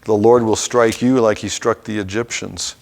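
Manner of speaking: normal talking voice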